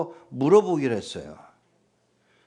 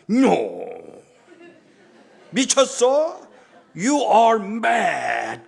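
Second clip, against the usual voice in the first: indignant shout; 7 semitones higher, 3 dB louder, much slower than usual. Retold or imitated.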